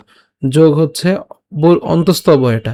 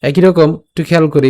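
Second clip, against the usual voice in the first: ordinary talk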